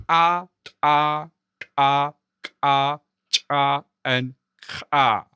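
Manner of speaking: loud popped ejectives "ta", "ka", "cha", spaced apart; ejective demo. Shown as a demonstration. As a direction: pop each consonant of "ta", "ka", "cha" from the larynx before the vowel. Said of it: "push air up from your larynx".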